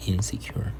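hushed unvoiced insecure; whisper. Shown as a demonstration. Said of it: "whispered vocabulary word".

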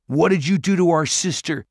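high, loud demand. A narrator's reading. Simon confronting the Dwarf Lord about his sister, demanding. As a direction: demand an answer, alarmed. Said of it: "Simon demanded".

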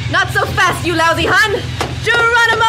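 loud, high heroic war cry; jumping from the plane, yelling; battle cry. Pretend. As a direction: leap and yell. Leaping after villain.

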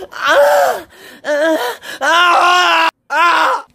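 high loud repeated screams; screaming. Acted. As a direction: scream out in pain, again and again. Dad screaming in pain.

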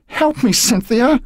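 panicked cry for help; cried out. A narrator's reading. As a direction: call out for her as you fall. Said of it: "she cried out".

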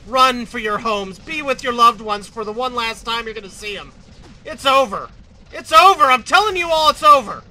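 loud, hammed-up cry of defeat; doomsday shouting. Announces humanity's doom to players.

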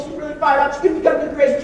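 ordinary delivery

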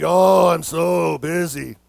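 very slow, low mock moan; mock busy person. Preacher mimicking people asked about their week, mock weary. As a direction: drag it out, hamming up the overworked sufferer.

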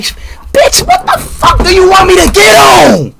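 real exasperated yell; furious shout. Real, not acted. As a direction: snap at the chat in rage. Furious at chat demands.

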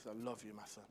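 very soft, hushed love to a newborn; whispered tender words. Retold or imitated. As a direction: murmur it gently to the baby. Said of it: "I said".

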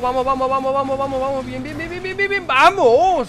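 high rising cheer into a shout; excited cheering. Real, not acted. Gamer urging his car over a jump, excited cheer.

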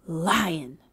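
gasp, then name the danger low; startled hush; gasped alarm. Acted. Bird spots the lion.

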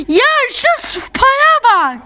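high, slow, squealing "spaya"; drawn-out delighted cry; excited squeal. Real, not acted. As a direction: gush over it in delight. Excited about the animal's spa.

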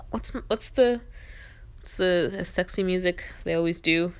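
usual speaking voice

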